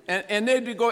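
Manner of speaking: ordinary delivery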